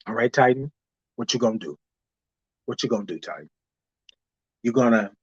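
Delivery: low, soft inner taunt; quiet self-dare, low and hushed. Retold or imitated. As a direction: goad yourself under your breath.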